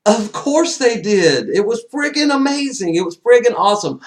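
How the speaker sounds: high, loud, thrilled; excited gush. Retold or imitated. Fan to a friend, high excited gush. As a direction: gush like a fan who just left the theater. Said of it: "I'm like".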